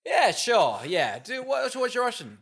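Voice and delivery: high, loud, improvising bluff; stall, then pitch it up high as if winging it; mock-uncertain agreement. Producers bluffing the actress about Russian, mock-uncertain.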